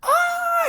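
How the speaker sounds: high squealing schoolgirl scream; mock girly shriek of delight. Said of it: "I screamed like the little schoolgirl".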